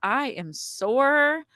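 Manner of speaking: slow, high mock-whiny "I am sore"; drawn-out complaint. Midwife teasing the mother after the birth, slow and high. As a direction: stretch every word like an aching helper. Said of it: "she said".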